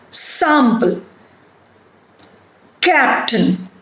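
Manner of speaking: each word from "Sample" to "lovely" stressed on its first syllable; isolated words, "Sample" to "lovely", each starting strong and falling. Shown as a demonstration. Teacher asking learners to hear stress, "Sample" to "lovely" front-stressed. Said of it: "It is on the first syllable".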